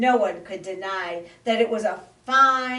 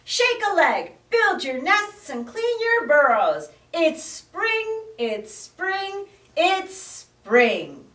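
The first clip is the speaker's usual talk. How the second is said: high, loud, chirpy call; cheerful shout; 9 semitones higher, 5 dB louder than usual. Acted. Frog calls the whole forest awake, shouting. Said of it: "he shouted".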